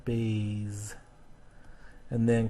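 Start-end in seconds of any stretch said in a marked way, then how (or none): 0.0-1.0 "Bays" drawn out, softer, ending in Z; voiced Z ending. Shown as a demonstration. Teacher confirming the Z column, "Bays" with voiced ending. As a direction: hold the buzzing Z in "Bays". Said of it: "so we go in the Z column".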